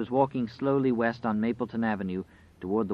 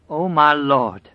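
loud, slow, raised cry to God; great quavering cry; 5 semitones higher, 8 dB louder, slower than usual. A narrator's reading. Woman waking from her dream cries to God, quavering cry. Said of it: "cried in a great and quavering voice".

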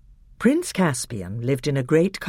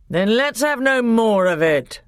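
firm king; 9 semitones higher, 6 dB louder than usual. A narrator's reading. King ends the matter.

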